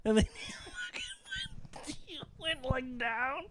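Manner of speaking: cracking up mid-sentence; squealing with laughter; laughing. Real, not acted. Laughing while retelling a friend's reaction.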